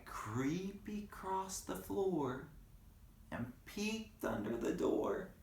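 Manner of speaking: high, soft, slow creeping; sneaky hush. Acted. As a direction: tiptoe through the words. Sneaking narration.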